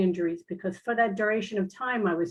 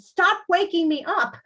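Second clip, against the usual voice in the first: mock demand; 9 semitones higher, 5 dB louder than usual. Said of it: "a big note on the door that said".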